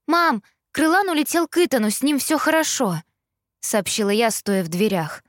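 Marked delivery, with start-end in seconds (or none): 0.1-3.0 relieved. A narrator's reading. Relieved news to mother.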